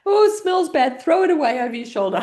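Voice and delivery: high, loud mock dismay; play-acted disgust. Pretending the drink smells bad, loud mock dismay.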